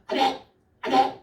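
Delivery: imitating an old pastor's exclamations; mock shouted amens. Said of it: "소리 지르는 그런 목사님이".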